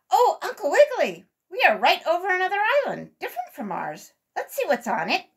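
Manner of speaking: put-on a child pointing something out in wonder; excited cry. A narrator's reading. Sammy spotting a new island from the airship, excited. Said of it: "cried out".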